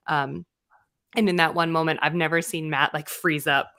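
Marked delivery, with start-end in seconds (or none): none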